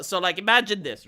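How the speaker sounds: ordinary delivery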